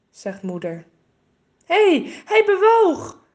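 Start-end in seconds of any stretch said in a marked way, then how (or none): 1.6-3.2 high, loud, slow startled cry; sudden excited cry. A narrator's reading. Boy suddenly cries out to his sister, high and startled. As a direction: blurt it out, amazed it moved. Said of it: "roept René opeens".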